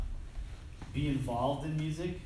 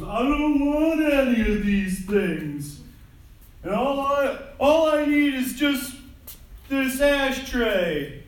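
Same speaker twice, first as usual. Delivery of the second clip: high, loud, slow simple-minded whine; dopey movie character; 12 semitones higher, 11 dB louder, slower than usual. Retold or imitated. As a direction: stretch every word like a clueless man-child. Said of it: "he just goes".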